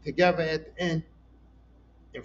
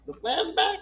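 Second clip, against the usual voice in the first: ordinary delivery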